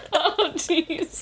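high, breathless laughing. Real, not acted. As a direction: wheeze out the words laughing. Laughing at the joke.

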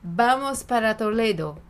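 Portuguese line with a Spanish accent; Spanish b for v, clear final o. Shown as a demonstration. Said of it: "Falar com sotaque espanhol".

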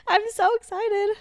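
high, giggly excitement; giddy laughter. Real, not acted. Giddy joking about being chipper.

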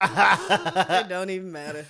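laughing. Real, not acted. Laughing, confused.

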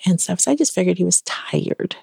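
normal talking voice

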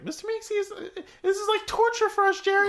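high, eager cartoon helper voice, laughing through it; Mister Meeksy voice. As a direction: squeak it up like a chipper little helper who exists to serve. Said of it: "when Mister Meeksy's like".

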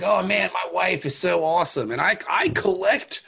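mocking crewman. Mocking doomed crewman's bragging.

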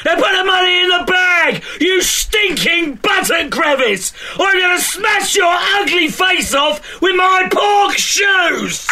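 shouting armed robber, fast and rough; bank robber. Acted. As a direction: bark it like a hardened robber. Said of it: "You're very good at this".

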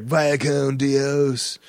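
very slow, drawn-out surfer-movie farewell; slow imitation of a film star's farewell line; Keanu Reeves. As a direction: stretch it out like a mock movie hero. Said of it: "the surfing movie, Keanu".

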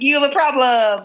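high, put-on scolding voice; prim mock lecture; mock stern voice. Mimicking a character's judgmental line.

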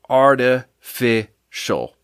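"artificial" broken into slow, separate chunks; syllable by syllable. Shown as a demonstration. Host judging the drink's taste, "artificial" slowly broken up. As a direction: pause between each part of "artificial". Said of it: "Three syllables".

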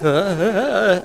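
nervous stammer. Acted. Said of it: "backward stagger step".

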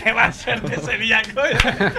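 can barely speak for laughing; high-pitched giggly line. Real, not acted. Laughing at joke.

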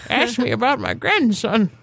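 a hint of a proud grandma; lightly voiced old lady. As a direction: play an old lady through laughter. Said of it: "An old lady".

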